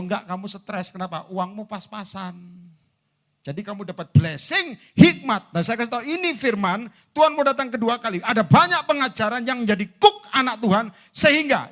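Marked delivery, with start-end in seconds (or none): 4.1-5.6 high, punched-out key words; emphatic preaching. Real, not acted. Preacher urging blessing with wisdom, loud and emphatic. 6.0-11.7 high, loud pulpit warning; fervent preaching. Real, not acted. Preacher warning of Christ's return, loud and fervent.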